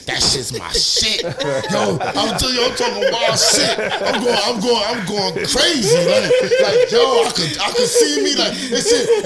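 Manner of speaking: very high, loud, laughing through the words; bragging, cracking up at himself; hyped laughing brag. Retold or imitated. Retold bragging after the pod.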